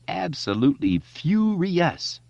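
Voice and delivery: high, slow mock-outraged mother; mimicking mom. A narrator's reading. Richie imitates his furious mother for Stan and Eddie. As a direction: ham up mom's fury.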